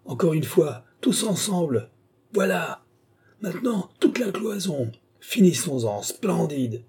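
high, urgent commands; a commander spurring his people on; urgent rallying. Acted. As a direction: drive the group to smash the wall. Commander urging others to break wall.

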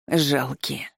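gurgled through blood in throat; words bubbling up the throat; gurgling. A narrator's reading. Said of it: "булькнула она горлом". (булькающим горлом)

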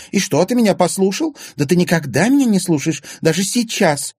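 loud, high, outraged scolding; shrieking. A narrator's reading. Anfisa scolding Egor for never listening, shrieking. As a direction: screech the reproach at him. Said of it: "визжала Анфиса Зверева".

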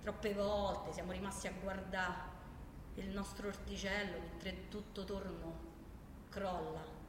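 normal talking voice

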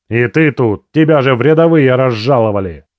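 loud angry growl. A narrator's reading. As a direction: snarl it out at him. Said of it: "прорычал дантист".

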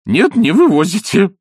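loud high indignant cry; high protest. Acted. Изнуренков protesting to Ostap, high indignant cry.

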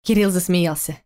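plain reading voice

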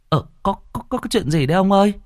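high, fast, stammering worried wife; startled woman's voice, stumbling over words; alarmed wife. A narrator's reading. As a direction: blurt out the question in a rush of fear. Wife woken by husband's cries.

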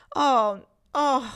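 loud, high shocked exclamation; scandalized "oh"; exclaiming. Acted. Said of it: "esclamò Miss Ophelia".

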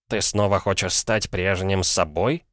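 ordinary delivery

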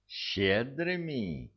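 high, drawn-out indignant echo; incredulous irritation, pitched high and slow; flaring up. A narrator's reading. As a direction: throw the word back, stretched and indignant. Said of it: "не на шутку вспылил Дирк".

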